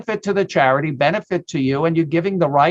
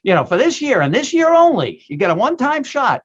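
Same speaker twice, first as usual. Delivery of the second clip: infomercial voice; 6 semitones higher than usual. Acted. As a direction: sell it like a late-night TV ad. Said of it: "now I sound like an infomercial".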